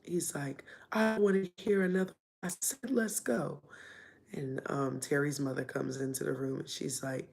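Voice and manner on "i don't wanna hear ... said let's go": angry father. Retold or imitated. Angry father cuts her off.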